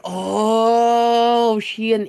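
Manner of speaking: long drawn-out, high, loud "oooh"; mock collective amazement; awestruck crowd. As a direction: stretch it out like a gawking crowd. Crowd reacting to a shown badge.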